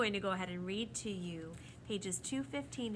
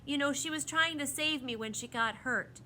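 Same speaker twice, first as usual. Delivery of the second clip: mildly worried, raised voice; anxious; 7 semitones higher, 7 dB louder than usual. A narrator's reading. A boy explains how the bird got hurt, mildly anxious. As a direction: plead her case with some worry. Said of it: "the boy asked anxiously".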